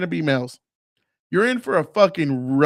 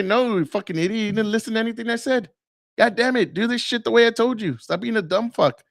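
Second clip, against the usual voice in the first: raised pitch, blunt mock scolding; rough guy talk; 6 semitones higher than usual. Dude tearing into his buddy for not listening, mock rough scolding. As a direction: chew out a buddy, no sugarcoating. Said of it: "that's the way we talk to each other".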